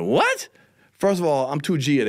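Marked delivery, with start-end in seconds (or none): 0.0-0.5 high, incredulous what; a sharp high disbelieving what; shocked what. Retold or imitated. Hearing the rumour about his wife, high shocked what. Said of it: "I was like".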